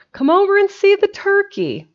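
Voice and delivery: very high, loud sing-out; calling out. Acted. Jimsy calls Specs to see the turkey, hollering.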